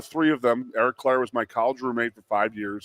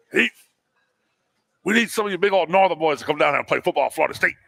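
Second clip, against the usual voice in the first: put-on higher, fast drawling old coach voice; Bobby Bowden impression; 4 semitones higher, faster than usual. Head coach recruiting a Northern boy, quick high folksy voice. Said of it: "that's exactly how he said it".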